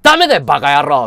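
loud, fast scolding bark; angry yell. Retold or imitated. Yakuza boss yelling at Sano, fast angry yell. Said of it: "って言ったら".

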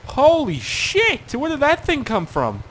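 real alarm at a sudden crash; stretched-out shocked shout; shocked outburst. Real, not acted. Vehicle spinning out of nowhere.

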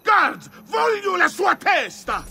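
furious call for guards. Acted. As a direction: bellow for the guards in fury. Man calls guards to kill.

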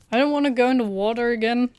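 loud, fast, reluctant complaint; whiny protest. Real, not acted. Player facing the water with mines in it, protesting. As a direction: protest quickly and loudly, dreading it.

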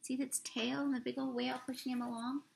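plain reading voice

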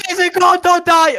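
high, loud desperate pleas; frantic yelling. Real, not acted. Player urging teammate not to die, frantic high shout. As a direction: scream at him to survive. Cheering teammate in tense moment.

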